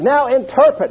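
mock preacher demanding; a touch of a stern preacher; imitating the preacher. Preacher voices Oral's command.

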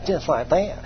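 softly mocking parrot; mocking echo. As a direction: mimic the boast under your breath, scornfully. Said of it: "I mocked him".

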